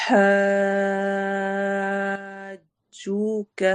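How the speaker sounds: حَاجُّوكَ drawn out very slowly, the long waw stretched and the ha joined onto the jeem; drawn-out Quranic recitation of حَاجُّوكَ; slow tajweed recitation. Shown as a demonstration. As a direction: recite حَاجُّوكَ at a crawl, joining حَاج to the jeem. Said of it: "جیم سے ملانا ہے".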